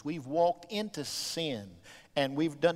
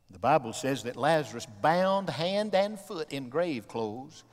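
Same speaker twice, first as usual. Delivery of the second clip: normal talking voice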